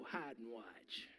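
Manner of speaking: slow, secretive whispered reply; sly whisper. Retold or imitated. Father whispering a tease to his kids. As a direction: hush it like a teasing secret.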